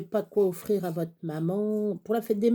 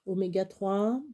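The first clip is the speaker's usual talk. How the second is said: normal talking voice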